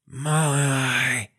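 breathing out one syllable. Shown as a demonstration. Teacher showing how syllables come out. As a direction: push the air out in one pulse. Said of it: "más o menos así".

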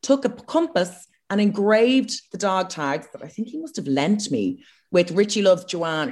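ordinary delivery

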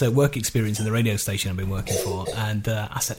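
ordinary talk, nothing marked

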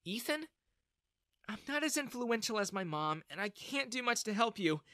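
high, tender confiding voice; earnest young woman. A narrator's reading. Daughter grabbing his hand to confide, earnest. Plays Bella confiding to Ethan.

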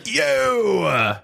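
high, shouted frontman yo; ska singer impression. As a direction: bark it like the band's singer. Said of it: "that's the impression".

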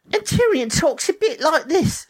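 very high Cockney dwarf voice; high-pitched mock Cockney character; Tyrion, Cockney. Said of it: "sound like a Cockney dwarf".

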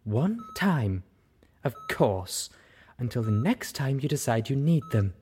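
bitter, biting sarcasm; seething. Acted. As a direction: spit it back at her, sarcastic. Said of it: "Velvet seethed".

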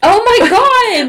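high, loud and drawn out; shocked exclamation. Real, not acted. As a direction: gasp it in shock. Shocked by killing.

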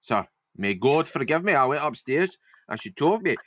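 drifting Scottish-northern, wandering as it goes; slipping northern accent. Acted. Lizzie confesses she was afraid, slipping northern accent. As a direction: let the fake northern accent slide about. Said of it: "I'm slipping into".

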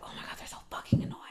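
whispered exasperation under the breath; annoyed mutter. Acted. Griping privately as someone's call comes in, annoyed whisper. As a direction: whisper a grumble nobody is meant to hear. Annoyed aside before answering a phone.

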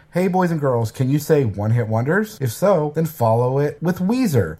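bright, high, sing-song host voice; kids show host. Acted. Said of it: "I'm just gonna read his whole review".

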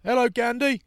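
high, loud sing-song greeting; cheeky heckler. Retold or imitated. Extra in the Extras scene greeting Ben Kingsley, high mock call. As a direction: call it out like a fan spotting a star. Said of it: "someone says".

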